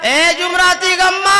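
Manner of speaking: high loud call for the wife; husband's call. Acted. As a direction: yell for her a third time. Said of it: "आवाज लगाया".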